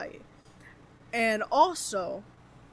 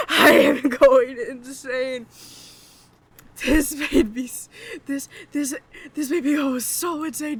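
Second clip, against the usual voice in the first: anguished yelling; 3 semitones higher, 9 dB louder than usual. Real, not acted. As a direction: yell it like you're losing it. Overwhelmed reaction.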